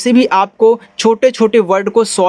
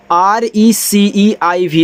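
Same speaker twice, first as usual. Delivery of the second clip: slow letter-by-letter spelling; much slower than usual. Shown as a demonstration. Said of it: "इसको पढ़ते हैं receive".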